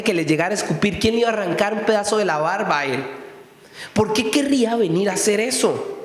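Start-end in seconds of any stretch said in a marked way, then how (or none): none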